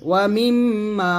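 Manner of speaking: "মিম" hummed long through the nose before "মা"; held ghunna. Shown as a demonstration. Teacher modelling for learners, nasal hold on "মিম". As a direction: hold the m of "মিম", then stretch "মা". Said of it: "সেখানে অবশ্যই গুণ করবেন".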